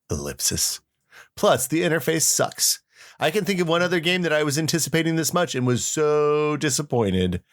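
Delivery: ordinary talk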